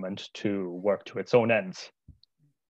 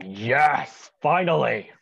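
re-enacted cheer, higher and louder; loud celebration; 5 semitones higher, 6 dB louder, much slower than usual. Brother retelling his win over his sister, loud celebrating cry. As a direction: celebrate it out loud, rising on the last word. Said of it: "loudly celebrate, saying".

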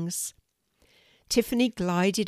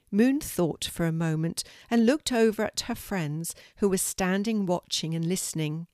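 usual speaking voice

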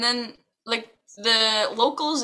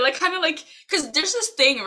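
ordinary talk

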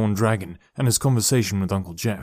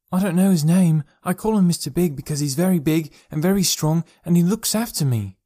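put-on higher, earnest little boy voice; young boy; 7 semitones higher, 3 dB louder than usual. Boy explains his invisible friend to his parents, in a higher childlike voice. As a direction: speak up like an eager small child.

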